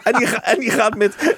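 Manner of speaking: laughing while talking; amused, words through laughter. Real, not acted. Laughing at the John de Mol scene.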